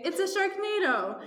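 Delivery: high, drawn-out warning cry; hammed-up alarm. A narrator's reading. Team warning of sharks, mock panic. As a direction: announce disaster theatrically.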